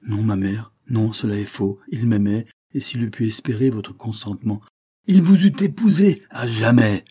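pleading denial, rising to a loud high cry; passionate protest. A narrator's reading. Daughter defends her lover to her mother, passionate. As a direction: defend him with growing fervour.

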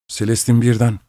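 plain reading voice

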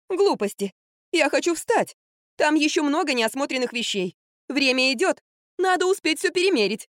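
high, fretful chatter; worrying. A narrator's reading. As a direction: fret that time is running out. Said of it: "беспокоилась Катя". (беспокойно, высоким голосом)

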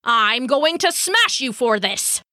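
angry god's threat; snarled threat. Acted. Thor threatening to smash Loki, snarled threat. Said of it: "Thor snarled".

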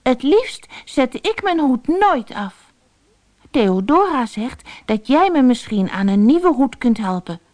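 put-on high boy's voice, earnest; little boy. Boy asks for help. (hoge kinderstem, ernstig)

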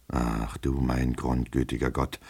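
slow, low, hushed exclamation; despairing groan. Acted. Master despairing at his servant's evasions, low and weary. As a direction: sigh it out to heaven in despair.

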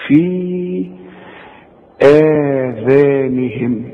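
آذانهم with the long a drawn out slowly; natural madd. Shown as a demonstration. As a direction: stretch the آ of آذانهم, natural length. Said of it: "كل همزة على السطر بعدها مد نمدها مد طبيعي".

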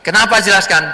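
defensive question. Acted. Young man asks defensively.

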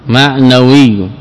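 معنوي stretched out slowly, the doubled ي held; drawn-out معنوي for students; slow teaching demo. Shown as a demonstration. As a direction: say معنوي slowly, leaning on the final ي. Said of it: "هنا ياء مشددة".

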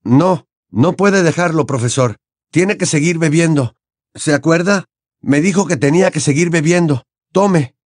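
put-on anxious, insistent boy; Harry, urging. A narrator's reading. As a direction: coax the suffering professor to continue.

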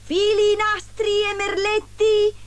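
high, drawn-out street-seller call; pedlar's cry. A narrator's reading. Disguised queen knocking at the door, pedlar's high cry. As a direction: sing out the wares in a high, stretched cry. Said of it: "gridò bussando alla porta".

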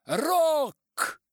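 high, very loud one-word shout; stern phone caller. A narrator's reading. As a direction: announce yourself sternly down the line. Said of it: "сурово сказала трубка".

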